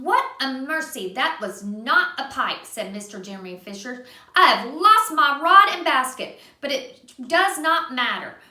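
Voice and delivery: put-on gentle read-aloud for a child; reading to a little one; storybook narration. A narrator's reading. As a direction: tell it like a bedtime story. Reading a picture book aloud.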